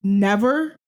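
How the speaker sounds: loud incredulous question, rising at the end; shocked disbelief. Retold or imitated. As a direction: react in disbelief to the news. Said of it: "And I was like".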